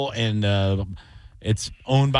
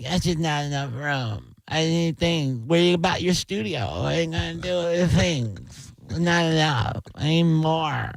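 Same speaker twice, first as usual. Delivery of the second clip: higher, softened, drawn-out mimicry of his wife; hammed-up complaining wife, pitched up; wife impression; 5 semitones higher than usual. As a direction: imitate your wife's objections in a high, slow voice. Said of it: "That was so close to her".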